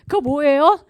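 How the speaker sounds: ordinary talk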